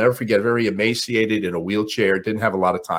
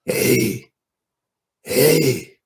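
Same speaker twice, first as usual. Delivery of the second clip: put-on frail old man; 6 semitones higher than usual. Frail old man calls Ali.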